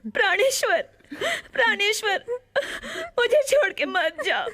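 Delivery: sobbing plea. Acted. Princess begging her dying beloved to stay, sobbing. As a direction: beg him through tears not to leave.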